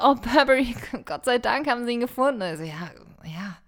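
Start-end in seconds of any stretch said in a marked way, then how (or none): 0.0-2.4 hammed-up relieved romance heroine. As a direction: gush with overdone relief. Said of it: "nehme ich Burberry und sag". (übertrieben erleichterte Heldin)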